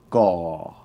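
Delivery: GAW with a falling tone. Shown as a demonstration. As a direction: let the pitch drop through "gaw". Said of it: "with a falling tone".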